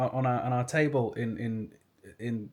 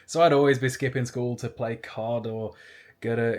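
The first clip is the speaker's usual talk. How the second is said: ordinary talk, nothing marked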